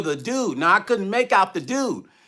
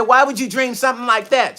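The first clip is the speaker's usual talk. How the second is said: mildly upset, higher-pitched retold protest; raised pitch, put-out questioning; 6 semitones higher than usual. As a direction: imitate an upset wife questioning you. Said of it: "She was upset".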